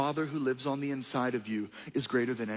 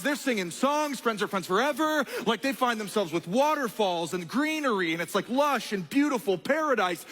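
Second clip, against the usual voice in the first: excited preaching; 8 semitones higher than usual. Acted. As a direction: lift the crowd with rising joy. Preaches the joyful part of the story.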